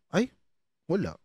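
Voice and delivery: usual speaking voice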